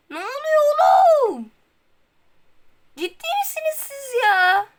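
shocked the team got better; shocked outcry. Real, not acted. Gamer shocked by team switch. (şok olmuş haykırış)

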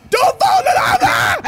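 high panicked shout; shouting at yourself not to fall in the lava; panicked yell. Real, not acted.

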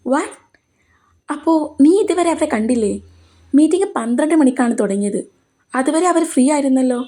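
lightly voiced surprise, disbelieving; surprised colleague. Anand surprised she hasn't met them yet, mild disbelief.

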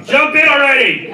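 high, loud impatient shout; yelling from window. Retold or imitated. Comedian yelling at the kid from his hotel window, loud. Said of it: "That was me from my window".

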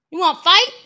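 loud playful dare; mock tough shout. Parent play-challenging toddler to a fight, mock tough shout. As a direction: pretend to pick a fight with the toddler.

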